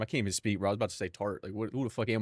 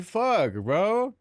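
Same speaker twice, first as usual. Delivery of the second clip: higher, exasperated "fuck, bro"; frustrated outburst; 7 semitones higher than usual. Real, not acted. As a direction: blurt out in annoyance.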